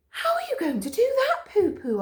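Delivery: very high curious dog; high curious Karen. A narrator's reading. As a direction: ask eagerly. Karen asks.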